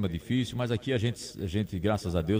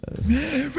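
very high, slow cartoonish squeak; squeaky high voice. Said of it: "Eu posso fazer voz". (voz fininha e aguda de brincadeira)

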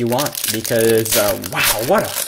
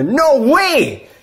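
excited yelling; 11 semitones higher than usual. Real, not acted. Collector yelling at his cats' expense over a rookie card, real excited yelling. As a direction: scream it like the pull of your life. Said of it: "I've been yelling for the past two minutes".

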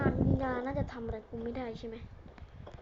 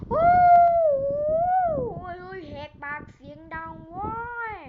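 drawn-out pained wail; 7 semitones higher, 7 dB louder, much slower than usual. Acted. In-game character being attacked.

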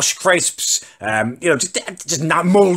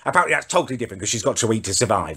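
normal talking voice

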